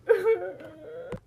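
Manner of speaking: tearful laughing fit. Real, not acted. Cracking up at her own sarcasm, tearful laugh. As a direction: break into wet laughter.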